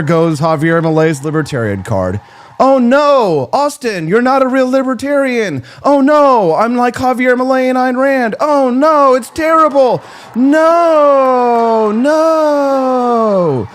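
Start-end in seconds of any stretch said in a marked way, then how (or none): 2.6-13.7 high, loud hammed-up wailing; over-the-top pretend panic, pitched way up; mock horror. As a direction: wail in fake dismay, drawing out the final no. Mocking critics with fake horror.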